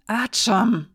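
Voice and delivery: rumbling anger. A narrator's reading. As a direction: cut him off in anger. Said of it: "schwang grollende Wut mit".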